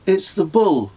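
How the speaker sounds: a hint of a firm child. A narrator's reading. Son warning dad about the bull, firm. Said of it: "he said firmly".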